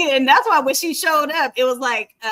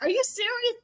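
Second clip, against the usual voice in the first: higher, mock-shocked husband; shocked disbelief; 11 semitones higher, 5 dB quieter than usual. Husband stunned by his wife's answer, higher mock disbelief. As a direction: ask it like you can't believe it. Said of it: "He was like".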